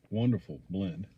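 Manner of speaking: usual speaking voice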